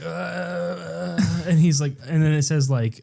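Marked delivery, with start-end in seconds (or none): 0.0-1.2 imitated moan. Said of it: "they're like".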